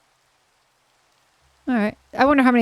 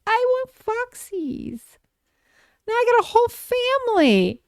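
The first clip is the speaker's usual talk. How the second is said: mock whiny self; 11 semitones higher than usual. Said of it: "'Cause I was sitting there going".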